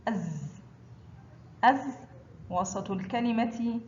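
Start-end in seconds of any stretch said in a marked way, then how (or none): 0.1-2.0 "أز" with the vowelless z sustained; sukun on zay. Shown as a demonstration. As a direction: say "أز" ending on a buzzing z. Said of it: "حرف الزاي فوقه سكون ينطق". (نطق "أز" بزاي ساكنة)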